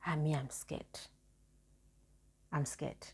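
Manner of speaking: small frightened whisper, slow; scared whisper. Retold or imitated. To Mama about her results, a scared whisper. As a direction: breathe it out, too scared to speak.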